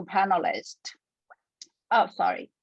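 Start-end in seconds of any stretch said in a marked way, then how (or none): none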